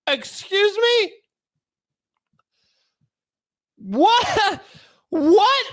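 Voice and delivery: high, drawn-out disbelief; mock shocked. Pretending to be stunned hearing the defender. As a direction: act floored by the news. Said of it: "mock shock at judge".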